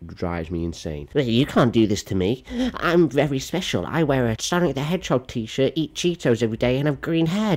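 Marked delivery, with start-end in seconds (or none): 1.1-7.6 higher-pitched mocking impression; whiny offended student. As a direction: play self-important and aggrieved, hammed-up. Mocks the offended tail wearer.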